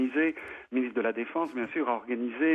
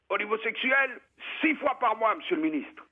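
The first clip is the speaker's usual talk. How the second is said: para at attention, pitched way up; soldier answering; 8 semitones higher than usual. Retold or imitated. Small para answers minister.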